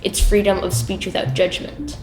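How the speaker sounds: ordinary talk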